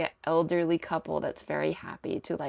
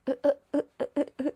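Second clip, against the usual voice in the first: flustered stammer; 9 semitones higher, much faster than usual. Retold or imitated. Din flustered and speechless.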